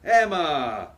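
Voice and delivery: calling out. Acted. Greeting niece on arrival.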